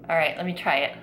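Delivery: ordinary delivery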